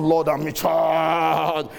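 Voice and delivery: drawn-out, hollered boast; mock boastful shout. Preacher mocks a husband claiming to be in charge, boastful shout. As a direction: stretch it into a slow, mocking holler.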